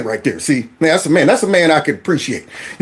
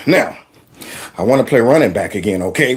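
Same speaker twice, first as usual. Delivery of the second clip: low insisting player; 8 semitones lower than usual. Retold or imitated. Player speaks low and firm.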